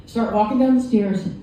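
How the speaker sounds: ordinary talk, nothing marked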